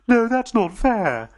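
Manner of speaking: high, slow, pleading protest; dismayed character voice. Acted. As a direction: let the disappointment stretch out.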